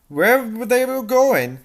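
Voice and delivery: ordinary delivery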